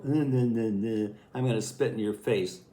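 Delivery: lightly voiced teasing sister pinning him down; a hint of a bratty sister gloating; taunting big sister. Older sister taunting her little brother, mock gloating. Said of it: "she would go".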